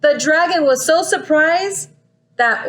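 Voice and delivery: plain reading voice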